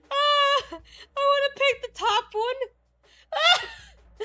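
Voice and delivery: high, drawn-out squeal through laughter; real helpless high-pitched giggling; laughing hard. Real, not acted. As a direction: crack up while talking. Cracking up over the game choice.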